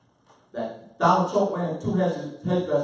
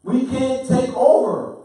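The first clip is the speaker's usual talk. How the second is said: loud, slow, raised-pitch declaration; forceful preaching; 11 semitones higher, 12 dB louder, slower than usual. Real, not acted. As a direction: preach it slowly at full voice. Emphatic preaching to the group.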